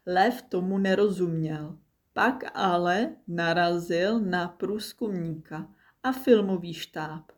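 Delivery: plain reading voice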